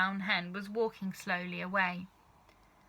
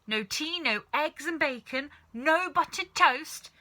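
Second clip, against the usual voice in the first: put-on a cranky old grandmother complaining, rising to louder; grumbling grandma; 6 semitones higher, 5 dB louder than usual.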